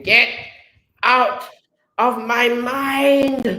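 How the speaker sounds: high, loud, anguished yell; agonised villain scream, drawn out; pained scream. Acted. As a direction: scream in torment, stretching the last word. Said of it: "he's pain scream".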